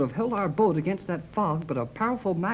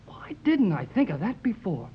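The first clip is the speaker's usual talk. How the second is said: ordinary talk, nothing marked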